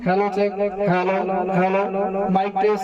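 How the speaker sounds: mic test with echo turned all the way up; heavy reverb on the amplified voice; full mic echo. Shown as a demonstration. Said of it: "ফুল করে দিলাম".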